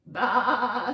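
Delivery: loud, wavering baa; sheep bleat. Acted. Sheep answers the mask riddle, loud quavering bleat. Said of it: "says the sheep".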